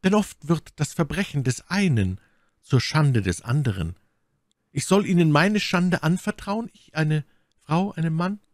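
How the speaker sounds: high, shamed lady's question; ashamed woman. A narrator's reading. My Lady voiced by narrator. (My Lady fragt Felton beschämt, mit hoher Stimme)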